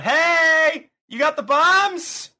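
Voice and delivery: high shout from the car; calling out. Acted. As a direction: call out, laughing.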